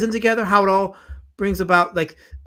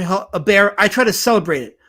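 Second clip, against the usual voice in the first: ordinary talk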